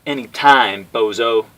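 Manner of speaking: loud taunting jeer. Acted. Guile goading Bison to keep him talking, loud taunt. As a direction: goad him on. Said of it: "Guile taunted the man".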